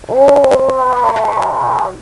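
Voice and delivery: long, loud mock monster bellow; drawn-out beast groan; creature roar. A narrator's reading. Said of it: "goes the creature".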